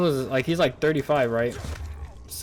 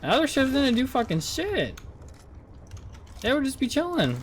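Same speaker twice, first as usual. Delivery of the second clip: indignant; 10 semitones higher than usual. Real, not acted. Complaining about other shifts.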